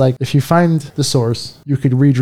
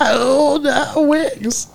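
laughing; 12 semitones higher, much slower than usual. Real, not acted. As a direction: voice cracking with high laughter. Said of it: "just had to break in my voice".

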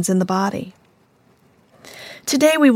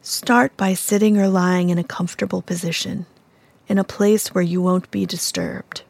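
calm, unhurried meditation voice with long pauses; soothing, even-toned mindfulness guidance; guided meditation; 6 dB quieter than usual. Real, not acted. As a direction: gently guide the listener inward, evenly and without rush.

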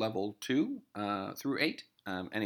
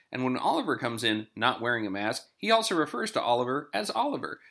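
ordinary talk, nothing marked

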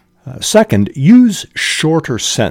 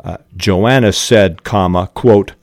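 ordinary delivery

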